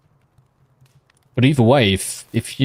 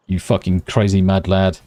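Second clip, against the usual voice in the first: normal talking voice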